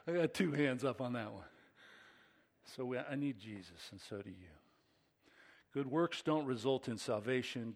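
usual speaking voice

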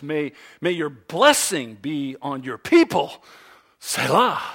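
loud, building to a ringing cry; impassioned scripture reading. Acted. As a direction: proclaim the psalm at full force. Reads the psalm at full force.

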